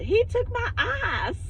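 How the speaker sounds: high, slow, wailing imitation of the lady; a light whiny take on the intruding woman; crackhead lady imitation. Imitating the crackhead lady who burst in, high and slow. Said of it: "she said it like that".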